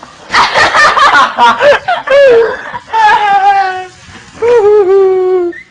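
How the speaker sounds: loud laughing fit. Real, not acted. Bursts out laughing.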